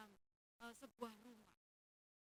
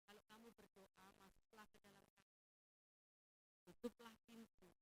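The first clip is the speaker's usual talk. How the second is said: ordinary talk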